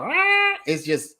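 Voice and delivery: high mock nagging "Ah"; a quick high snatch of her nagging tone; imitating her nagging. Host mimicking the lawyer's nagging Southern drawl, high mock "Ah". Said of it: "when I hear her start getting".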